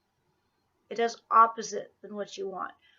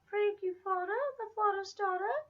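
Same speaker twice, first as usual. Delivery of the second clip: high sing-song pet voice; high baby talk; 9 semitones higher than usual. Acted. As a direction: coo the nickname up high. Cooing at the dog.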